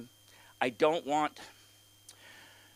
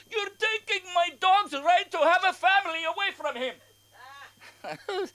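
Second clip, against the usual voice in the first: high, drawn-out mock plea; impassioned objector; 14 semitones higher, slower than usual. Dog owner arguing at council meeting, mock impassioned. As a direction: play an aggrieved dog owner, pitched way up. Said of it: "the best arguments I ever heard".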